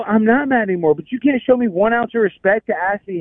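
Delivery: high-pitched retold grievance; exasperated reproach. Retold reproach about lack of respect.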